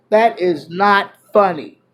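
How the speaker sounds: usual speaking voice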